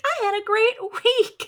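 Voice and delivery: high, sing-out mock cheer; fake cheeriness. Co-host mocking pretending all is fine, high fake cheer. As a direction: put on a falsely upbeat high voice.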